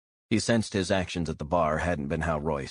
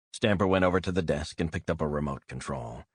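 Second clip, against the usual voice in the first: ordinary delivery, nothing marked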